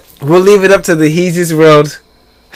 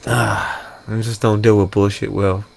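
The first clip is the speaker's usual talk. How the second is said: much softer, low mutter; quiet; 10 semitones lower, 12 dB quieter than usual. Real, not acted. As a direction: drop the voice to a mutter. Muttering personal aside.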